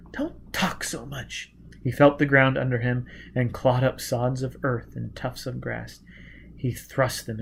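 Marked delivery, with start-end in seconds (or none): 0.1-1.5 put-on hopeless girl's whisper. A narrator's reading. Gisbella whispering to Foyle that no one will find them. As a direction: barely breathe the words.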